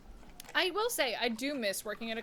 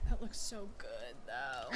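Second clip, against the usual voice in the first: soft unvoiced whisper; 10 semitones lower, 7 dB quieter, slower than usual. Real, not acted. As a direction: whisper it like ASMR. Whispered over food video.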